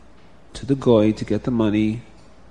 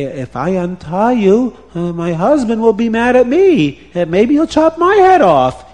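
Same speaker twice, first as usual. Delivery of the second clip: high, loud fretting; worried woman; 8 semitones higher, 6 dB louder than usual. A narrator's reading. Farmer's wife fearing her husband's anger, alarmed. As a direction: fret aloud, pitch climbing as fear builds.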